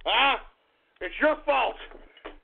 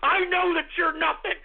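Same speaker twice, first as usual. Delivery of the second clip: ordinary talk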